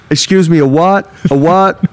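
puzzled American call-centre worker, hammed up; American accent. Call centre hearing of a cassowary attack, mock American accent. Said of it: "I'm doing an American accent".

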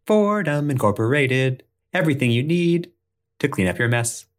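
high, chirpy commercial announcer; ad jingle voice. Acted. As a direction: sell it like a cheesy ad. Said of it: "the jingle belonging to Fordham Incorporated".

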